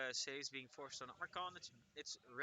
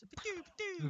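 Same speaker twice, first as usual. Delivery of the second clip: very high blaster noises; pew-pew laser imitation; laser sound effect; 3 dB louder than usual. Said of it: "the lasers are the shots".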